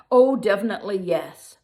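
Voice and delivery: loud, hasty yes; the assistant hastily agrees with the lion; eager loud agreement. Acted. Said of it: "said the tailor's assistant".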